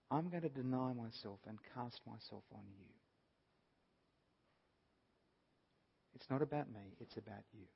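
soft, subdued plea sinking away; humble surrender. Acted. Father casting himself on Jesus, humbly surrendering. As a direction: speak softly, giving yourself over.

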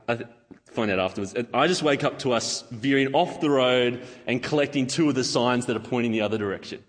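ordinary talk, nothing marked

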